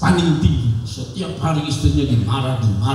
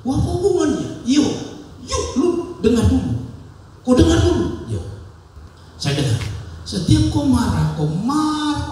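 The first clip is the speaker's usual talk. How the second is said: Papuan wife's voice; 8 semitones higher than usual. Retold or imitated. Wife Yakomina telling her husband her secret, high Papuan voice. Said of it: "gaya Papua".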